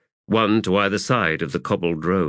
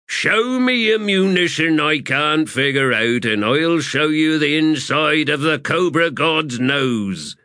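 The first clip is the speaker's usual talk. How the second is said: boastful corporal; 9 semitones higher, 4 dB louder than usual. Acted. Corporal boasting to sapper about munitions, boastful. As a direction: brag it out, drawing the words long.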